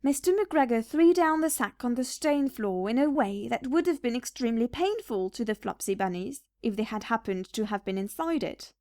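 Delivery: ordinary delivery, nothing marked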